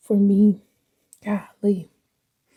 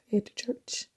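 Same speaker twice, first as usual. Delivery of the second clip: whisper; 12 dB quieter than usual. Real, not acted.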